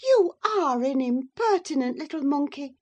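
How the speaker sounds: high, loud, affronted surprise; surprised exclamation. A narrator's reading. Mrs. Linton to an impertinent girl, in surprise. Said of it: "exclaimed Missus Linton, in surprise".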